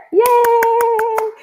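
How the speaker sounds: high loud squeal; squealed yay. Real, not acted. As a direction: cheer the winner in. Second winner appears.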